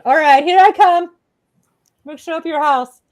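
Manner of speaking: hammed-up puppy bravado; eager pet. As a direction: voice a puppy barging in. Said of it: "They're like".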